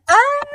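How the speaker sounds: short high-pitched loud yelp; mock scream. Breaking off mid-remark about the fire, mock scream. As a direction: cry out in a sudden high "ah".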